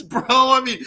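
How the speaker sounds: ordinary delivery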